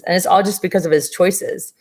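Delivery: usual speaking voice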